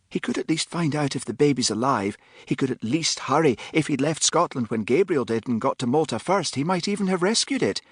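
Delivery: put-on a headstrong girl demanding action; young Philippa, impatient. A narrator's reading. Philippa's impatient complaint in dialogue.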